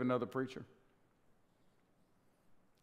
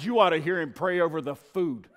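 laughing; 6 semitones higher, 6 dB louder than usual. Real, not acted. Laughing punchline.

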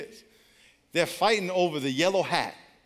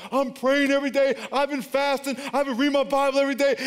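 quick, higher, eager self-justifying list; defensive churchgoer; 9 semitones higher, 5 dB louder than usual. Retold or imitated. Member assures the pastor, eager defensive list. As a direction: rattle it off like a proud member.

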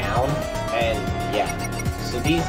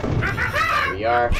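game voice shout; much slower than usual. Real, not acted.

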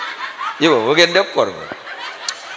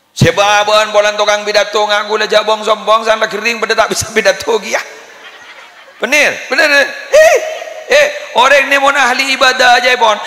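raised, loud sermon voice from the pulpit; preaching at full force; 7 semitones higher, 5 dB louder than usual. Acted. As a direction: drive the sermon home, high and loud. Preaches a long passage. (berkhotbah dengan suara lantang dan tinggi)

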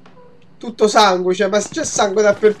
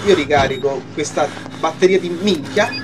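ordinary delivery

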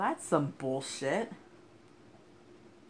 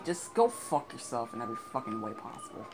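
usual speaking voice

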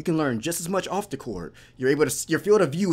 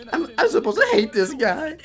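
high, laughing disbelief; mock incredulity; 13 semitones higher, 3 dB louder than usual. Reactor voicing a player who should hate this guy, incredulous. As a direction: squeak it out, baffled and amused. Repeats the quote, higher, incredulous.

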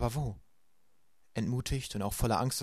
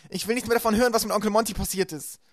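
much higher and louder, distraught; upset shouting; 11 semitones higher, 8 dB louder than usual. A narrator's reading. Violet shouts at Klaus, distraught and loud. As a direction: refuse to hear any more. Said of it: "schrie Violet".

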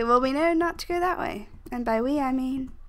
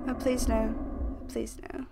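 usual speaking voice

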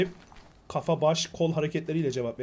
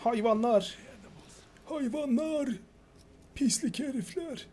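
put-on high, slow old man voice; grandpa voice; 8 semitones higher, 5 dB quieter, slower than usual. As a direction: voice the old man, strained and bitter. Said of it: "Dede sesi beni çok yordu ya".

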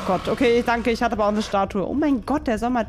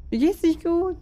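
creepy ghost voice; 7 semitones higher than usual. Pretend. Ghost voice spoken by player. (gruselige Geisterstimme)

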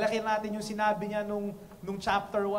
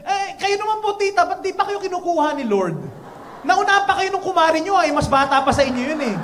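loud, high shouted retort; imagined comeback; 8 semitones higher, 9 dB louder than usual. Pretend. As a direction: fire back at the nosy tita. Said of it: "gusto ko na siya sanang sagutin".

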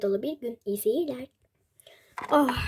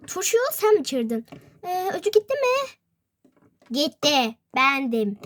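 ordinary talk

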